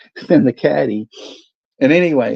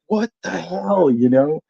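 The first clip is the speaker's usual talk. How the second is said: retold disbelief; 7 semitones higher than usual. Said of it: "I'm like".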